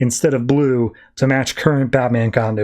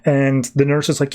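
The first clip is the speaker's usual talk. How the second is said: usual speaking voice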